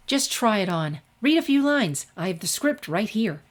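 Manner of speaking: insistent, pushing the script on her; a teacher pressing the costume on a reluctant student, eager and insistent; pushy professor. A narrator's reading. Lightly voiced, eager and insistent. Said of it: "heated arguing followed".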